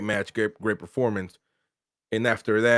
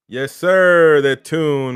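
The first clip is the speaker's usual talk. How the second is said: loud happy yell; excited cheer; 4 semitones higher, 9 dB louder, much slower than usual. Real, not acted. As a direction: celebrate it out loud. Celebrating the win.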